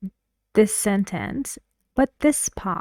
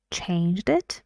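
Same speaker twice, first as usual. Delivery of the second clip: "Changed it" with the end swung up; rising query on "Changed it"; questioning repeat. Shown as a demonstration. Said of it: "he repeats it in a questioning way".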